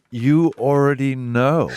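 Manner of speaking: slow, drawn-out mock swagger; lightly imitated laid-back certainty; knowing and cool. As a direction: lay it on slowly like it's obvious. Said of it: "he said it like".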